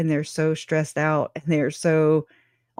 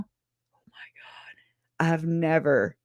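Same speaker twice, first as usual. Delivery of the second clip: whispered aside; 6 dB quieter, much slower than usual. Real, not acted. Recalling driving in Utah.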